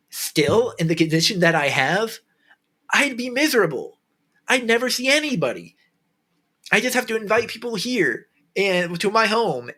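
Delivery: getting emotional. Real, not acted. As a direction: plead it from the heart, voice lifted. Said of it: "man, I'm getting emotional".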